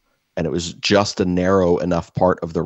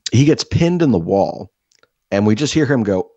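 normal talking voice

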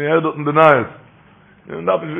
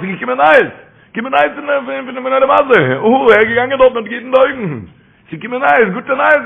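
excited preaching; 8 semitones higher, 3 dB louder than usual. Real, not acted.

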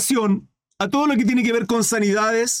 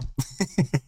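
short laugh; 7 semitones lower, 7 dB quieter than usual. Real, not acted. Laughs at own joke.

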